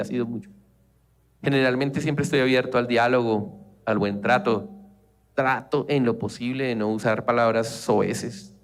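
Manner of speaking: ordinary talk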